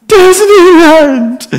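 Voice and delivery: high, drawn-out joyful cry of Disneyland!; excited kids' shout. Retold or imitated. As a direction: shout it like a thrilled child spotting the castle. Kids seeing the castle.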